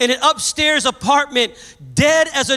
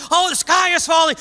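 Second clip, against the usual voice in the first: panicked horse; 6 semitones higher than usual. Acted.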